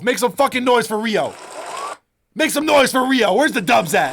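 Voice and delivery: loud, high hype-man shout; rally the crowd, shouting. Real, not acted. Hyping chat for Rio.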